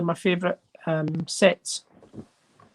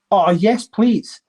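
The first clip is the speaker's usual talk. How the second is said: louder, delighted cry of yes please; eager yes please; 3 semitones higher, 6 dB louder, much slower than usual. Real, not acted. Thrilled collector to viewers, eager cheer. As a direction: grab the offer with glee. Delighted at finding a Saint Helena coin.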